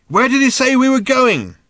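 high, loud yelled question; shouting in ear. A narrator's reading. Ford questions Arthur on the beast, shouting. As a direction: yell to be heard over hooves. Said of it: "Ford shouted in Arthur's ear".

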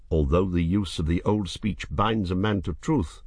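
ordinary delivery, nothing marked

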